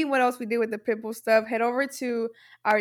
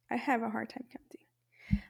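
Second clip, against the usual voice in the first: unvoiced whispered aside; whispering; 3 semitones lower, 12 dB quieter than usual. Real, not acted. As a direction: whisper it under your breath. Aside about miscounting.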